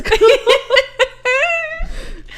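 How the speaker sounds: high breathless giggle; wheezing laugh. Real, not acted. Laughing at podcast name.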